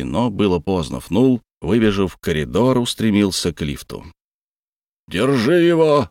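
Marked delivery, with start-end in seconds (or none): 5.1-6.1 shouting. A narrator's reading. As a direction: yell it down the corridor. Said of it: "во весь голос завопил майор Хоук".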